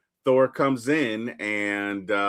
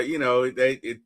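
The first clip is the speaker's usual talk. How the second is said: normal talking voice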